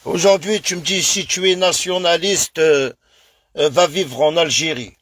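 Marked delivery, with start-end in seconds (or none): none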